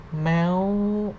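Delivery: "meow" high and climbing. Shown as a demonstration. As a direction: lift "meow" steadily upward. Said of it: "represent the high sound".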